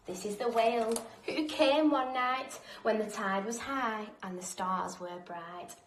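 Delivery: usual speaking voice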